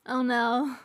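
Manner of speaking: ordinary talk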